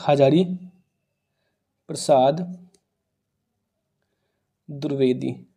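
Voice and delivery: low, soft, word by word with long pauses; slow-spaced, hushed dictation; murmuring while writing. Real, not acted. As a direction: say it quietly to yourself as you write.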